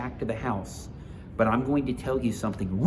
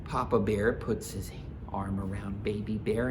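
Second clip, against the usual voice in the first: plain reading voice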